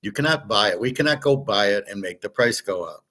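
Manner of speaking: ordinary talk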